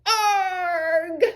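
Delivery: high loud yelp of dismay; pained cry. Acted. Charlie Brown yelps as Lucy pulls the ball. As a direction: cry out as you fly into the air. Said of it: "cried Charlie Brown".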